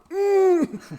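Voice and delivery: sharp high yelp of pain through closed lips; high pained squeal. Retold or imitated. Tire landed on her foot, high pained squeal. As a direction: squeak when the tire lands on your foot. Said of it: "and I went".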